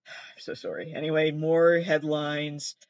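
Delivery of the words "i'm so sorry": sighed whisper. Real, not acted. Sighing apology after bad pun.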